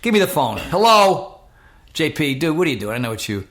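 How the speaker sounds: high, loud phone greeting, easing off; acted impatient holler settling down; brusque challenge. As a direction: call out the prankster on the phone. Challenges supposed prank caller on phone.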